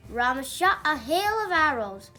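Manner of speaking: ordinary delivery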